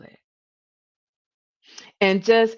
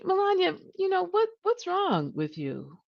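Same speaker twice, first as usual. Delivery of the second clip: gentle concerned version; 12 semitones higher, 5 dB quieter than usual. Shown as a demonstration. Manager asking Melania gently, out of real concern. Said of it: "Or did Katie say".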